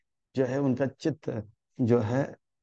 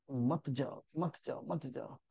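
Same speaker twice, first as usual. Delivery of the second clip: धीमे से मना करना; 8 dB quieter than usual. Acted. भगवान का धीमा निषेध.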